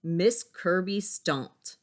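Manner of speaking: slow, weighty storybook line; slow dramatic narration. Acted. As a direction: lean into each word. Reads picture book aloud.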